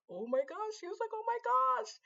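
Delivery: high, excited "oh my gosh"; giddy high exclamation; excited squeal. Retold or imitated. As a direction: squeal it with delight. Excited retelling of hugging friend.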